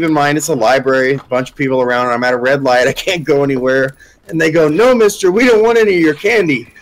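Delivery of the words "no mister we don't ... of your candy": raised, higher mock-kid voice; imitated taunting kids. Punk kids to van driver outside library, mock-kid voice. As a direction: call out like kids heckling a driver.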